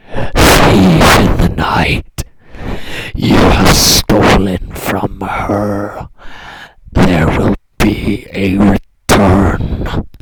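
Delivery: low, slow, loud rattling croak; dry croaking dead voice. Acted. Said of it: "The voice rattled out, dry and croaking".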